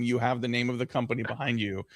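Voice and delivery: normal talking voice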